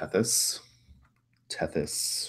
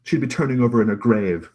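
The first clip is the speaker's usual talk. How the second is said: loud, higher reproach; screaming at someone; 5 semitones higher, 8 dB louder than usual. Acted. Brother invoking dead Mother, loud and reproachful. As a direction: keep the outraged voice raised. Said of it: "he kinda screams at you".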